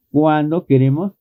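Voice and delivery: ordinary talk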